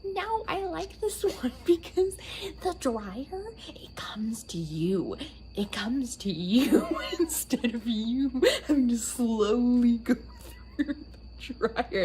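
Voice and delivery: high, then breathy excited hush; imitating mom. Imitating mom praising the dryer, high then hushed. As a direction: share the secret delight like a giddy mom. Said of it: "she's like".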